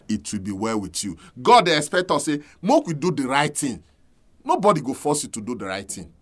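ordinary talk

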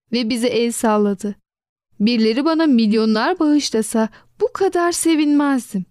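usual speaking voice

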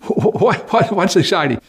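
high, bewildered question; puzzled skeptic. Acted. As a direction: ask in mock confusion. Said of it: "skeptic asks".

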